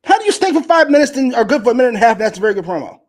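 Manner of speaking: loud, high disbelief; incredulous challenge. Real, not acted. Arguing with co-host over a promo, incredulous challenge.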